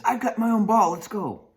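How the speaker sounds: eager friend. Pretend.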